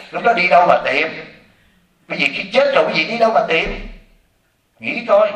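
ordinary talk, nothing marked

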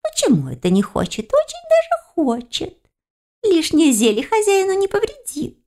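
high-pitched, piping objection; practical little creature. A narrator's reading. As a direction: contradict in a squeaky tiny voice. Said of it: "Практично решил кто-то".

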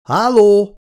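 nervous, high, loud hello; calling out again. A narrator's reading. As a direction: call out harder into the empty hall. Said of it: "A hangja visszhangot vert".

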